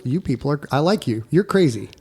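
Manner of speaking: ordinary delivery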